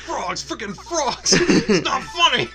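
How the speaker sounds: high, loud ranting; hammed-up furious rant; mock outrage. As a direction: rant like a conspiracy guy.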